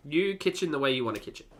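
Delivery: normal talking voice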